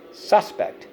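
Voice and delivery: SUS-pect, first syllable punched high; stress on first syllable. Shown as a demonstration. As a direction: hit the SUS of "Suspect" and drop on pect. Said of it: "On the first syllable".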